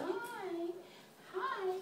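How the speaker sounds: high cooing greeting. Real, not acted. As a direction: coo hi to a puppy. Greeting the dog.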